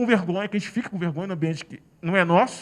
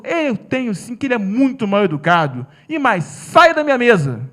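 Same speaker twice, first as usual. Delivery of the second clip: loud dismissal; 2 semitones higher, 8 dB louder than usual. Retold or imitated. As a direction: shove him off your table with words. Woman orders him away.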